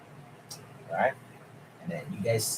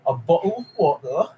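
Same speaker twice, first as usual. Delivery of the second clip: a giggling, lightly done British bottle of water; half-laughing British meme voice; mock British accent; 6 semitones higher than usual. Streamer repeating the British meme through laughter, mock accent.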